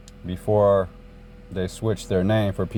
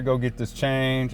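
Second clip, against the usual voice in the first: ordinary talk, nothing marked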